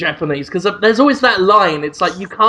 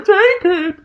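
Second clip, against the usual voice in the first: much higher, strained whimper of surrender; mock beaten biker; 13 semitones higher than usual. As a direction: hand it over from the floor, defeated. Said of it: "he's on the floor going".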